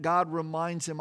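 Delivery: ordinary talk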